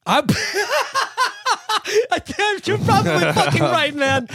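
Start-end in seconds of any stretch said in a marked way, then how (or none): 0.2-4.3 laughing. Real, not acted. Cracking up at friend's joke.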